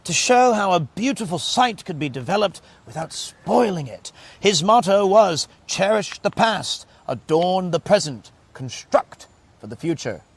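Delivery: deliberate, weighty booklet read-out; reading aloud. Real, not acted.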